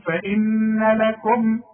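فإن لكم slowed, holding the first meem before the next; pause on the meem. Shown as a demonstration. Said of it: "إذا وقفت على الميم الأولى".

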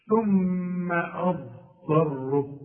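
"ثم أضطره" slowly, humming long on the doubled m; held ghunna. Shown as a demonstration. Teacher showing the shadda must be hummed, held ghunna. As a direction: hold the م of "ثم" in the nose. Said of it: "لازم أغنها عند الوقف وعند الوصل".

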